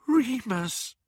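ordinary delivery, nothing marked

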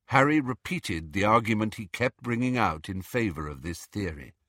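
plain reading voice

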